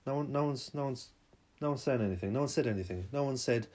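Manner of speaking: ordinary talk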